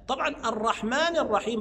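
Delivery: usual speaking voice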